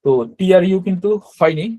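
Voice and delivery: usual speaking voice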